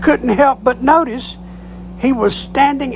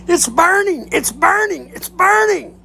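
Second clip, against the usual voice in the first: pitched-up mock pilot scream; 8 semitones higher than usual. Pilot yelling over the intercom, pitched-up mock scream. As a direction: yelp it out as if scalded. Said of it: "he starts screaming".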